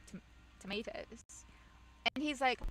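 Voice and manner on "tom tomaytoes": hesitant, quiet "tomatoes" with an AY in the middle; Canadian pronunciation. Retold or imitated. Canadian friend explains to shop worker, softly, "tomatoes" with AY. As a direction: softly stumble into "tomatoes" said tuh-MAY-toes. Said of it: "that's how they pronounce it".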